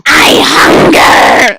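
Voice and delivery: very slow, loud, raspy shriek; unhinged creepy monster; insane creepy voice. Acted. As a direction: shriek it like a mad creature. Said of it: "batshit insane, creepy voice".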